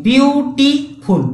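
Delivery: ବିୟୁଟିଫୁଲ୍ drawn out slowly, each syllable apart; syllable demo. Shown as a demonstration. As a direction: stretch ବିୟୁଟିଫୁଲ୍ so its three syllables are heard. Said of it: "କେତେଟା syllable ଅଛି". (ବିୟୁଟିଫୁଲ୍ ଶବ୍ଦକୁ ଧୀରେ syllable ଭାଗ କରି କହିବା)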